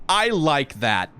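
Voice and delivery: drawn out, very slow approval; slow savoring. Shown as a demonstration. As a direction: stretch each word, savoring it. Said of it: "repeated approval, drawn out".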